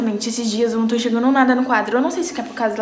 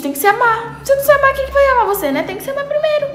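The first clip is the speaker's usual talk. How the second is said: high excited preaching; 11 semitones higher than usual. Real, not acted. Preaching self-love.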